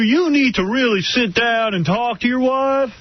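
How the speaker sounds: high, slow, drawn-out folksy counselor; motivational advisor impression. Advisor telling husband to talk to wife, hammed-up. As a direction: lecture like a hammed-up TV advice giver. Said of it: "he's like that motivational speaker".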